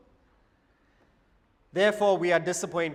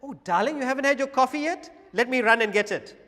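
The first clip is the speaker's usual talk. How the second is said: higher, louder, fast eager offer; eager doting husband; 6 semitones higher, 4 dB louder, faster than usual. Retold or imitated. Husband eagerly offering his wife coffee, higher and fast. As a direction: rush to please her, all eagerness. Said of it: "the husband says".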